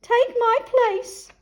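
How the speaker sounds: high, slow, scared plea; frightened. A narrator's reading. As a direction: beg him to take her place. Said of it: "a frightened Cinderella".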